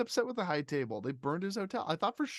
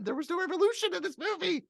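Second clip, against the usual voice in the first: mock upset; 4 dB louder than usual. Real, not acted. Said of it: "I'm a little upset".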